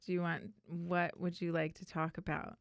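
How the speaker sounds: usual speaking voice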